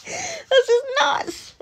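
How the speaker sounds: rising high, laughing disbelief; mock outrage at the absurd theory; incredulous. Recalling her reaction to the theory with high laughing disbelief. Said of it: "I thought".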